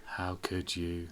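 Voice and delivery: slow, quiet and low; crushed, hardly getting it out; hurt. Acted. Betrayed husband confronts wife.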